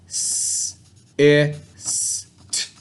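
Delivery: S, I, S, T, er slowly, one sound at a time. Shown as a demonstration. Teacher blends sounds of sister for kids. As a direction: segment the word slowly. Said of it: "And the last sound".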